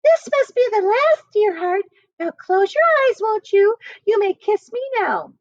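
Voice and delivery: man faking a high coy lady voice; high sugary put-on woman voice; teasing falsetto of a man playing a woman; high false woman's voice. A narrator's reading. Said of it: "called through the shutters".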